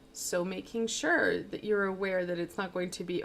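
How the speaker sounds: ordinary delivery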